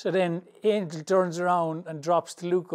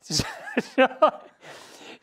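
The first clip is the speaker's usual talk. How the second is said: high breathless laugh; wheezy giggle at the memory; 9 semitones higher, 4 dB louder than usual. Real, not acted. Cracking up at his story.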